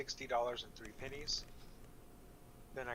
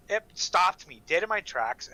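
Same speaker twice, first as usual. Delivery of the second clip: ordinary talk, nothing marked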